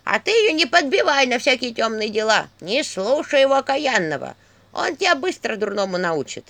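high, loud creaky scolding; creaky staff. A narrator's reading. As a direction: nag him in a creaky old staff voice. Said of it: "скрипучий голос посоха". (скрипучий, сварливый голос посоха)

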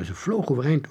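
ordinary delivery

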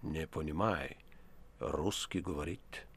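put-on low, slow, broken Russian with a foreign accent; hostile foreign accent. A narrator's reading. Foreign professor brushing off the poet in broken Russian. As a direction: answer coldly in a foreigner's bad Russian. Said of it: "ответил неприязненно".

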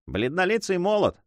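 usual speaking voice

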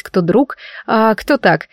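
usual speaking voice

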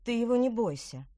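ordinary delivery

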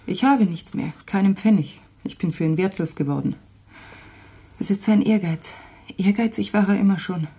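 ordinary delivery

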